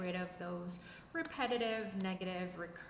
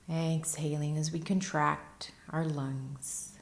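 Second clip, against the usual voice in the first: guided meditation; 2 semitones lower, 10 dB louder than usual. Acted. Teacher leading listeners into breath and stillness, guided meditation voice. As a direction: guide them unhurriedly through posture and breath, evenly paced.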